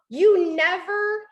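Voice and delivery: high, loud, drawn-out accusation; accusing. Acted. Partner blaming with absolutes, accusing. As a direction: stretch it out as an accusation. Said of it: "if you're like".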